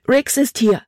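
high, urgent cry; alarmed exclamation. A narrator's reading. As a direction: call it out in alarm. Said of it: "rief sie aus". (aufgeregter, hoher Ausruf)

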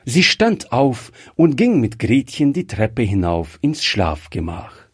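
usual speaking voice

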